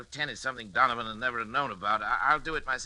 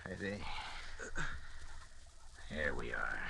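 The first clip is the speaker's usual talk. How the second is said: hushed whisper, much lower; 10 semitones lower, 11 dB quieter than usual. Acted. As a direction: breathe it to yourself over the patient. Doctor works on sedated son.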